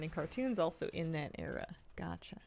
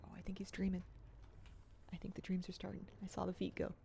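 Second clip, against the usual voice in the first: quiet so the dog sleeps on; 9 dB quieter than usual. Real, not acted. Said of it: "I'm trying to be quiet".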